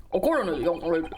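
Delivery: put-on stately judge voice; formal court authority. Judge asking the young lady for testimony, formal. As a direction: instruct the witness with solemn formality.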